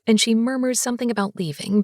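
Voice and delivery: ordinary delivery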